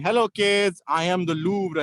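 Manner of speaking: normal talking voice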